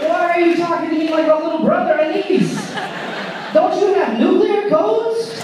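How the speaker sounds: high, loud mock astonishment; baffled disbelief. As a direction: question it in hammed-up bewilderment. Comedian baffled by president's casual greeting.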